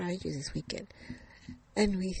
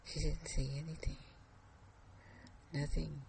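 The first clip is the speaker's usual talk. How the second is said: ordinary delivery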